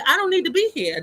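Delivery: voiced high and defiant; quoting someone refusing help; defiant quote. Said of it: "and they say".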